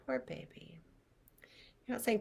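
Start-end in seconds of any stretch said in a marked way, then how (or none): 0.0-0.8 soft soothing. Shown as a demonstration. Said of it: "and you're saying".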